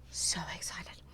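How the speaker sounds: drops to an unvoiced whisper on the repeat; whispered echo. Streamer echoing her own excitement, now whispered. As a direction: hush the repeat right down to breath.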